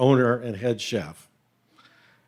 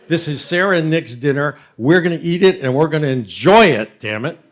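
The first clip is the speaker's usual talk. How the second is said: loud fatherly decree; 3 semitones higher, 8 dB louder than usual. Retold or imitated. Father's ruling at the table.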